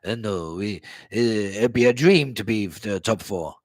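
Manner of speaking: lightly voiced low, hesitant manager; Conte. Fan quoting Conte on top four, lightly mimicked hesitancy. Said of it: "how many times did he say".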